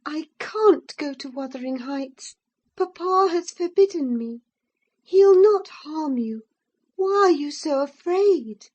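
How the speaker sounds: put-on high, pleading gentle girl; young girl voice. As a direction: coax him like a worried cousin. Voices Catherine in dialogue.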